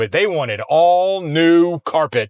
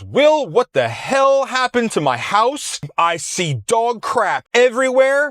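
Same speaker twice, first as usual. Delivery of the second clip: higher-pitched, outraged retelling of the son; imitating furious son; 8 semitones higher than usual. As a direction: play the livid young seller on the phone. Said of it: "pissed off as this young man was".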